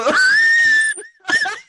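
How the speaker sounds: high shrieking laugh; loud laugh. Real, not acted. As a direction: burst out laughing loudly. Loud laugh after joke.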